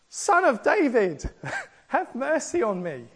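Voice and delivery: a blind beggar shrieking to Jesus, a pleading high cry; beggar's shriek. Acted. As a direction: call out desperately for mercy, pitched high. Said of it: "in the Greek it says he shrieked this".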